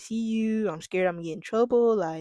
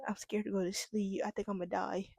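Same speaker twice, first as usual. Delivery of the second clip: much softer, retold fear; small scared voice; 8 dB quieter than usual. Calling mom after the fight, soft scared voice. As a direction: say it like a scared kid on the phone. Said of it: "I was like".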